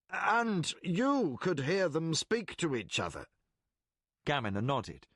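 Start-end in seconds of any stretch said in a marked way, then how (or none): 0.1-3.3 curious Colgan. Acted. Colgan asking.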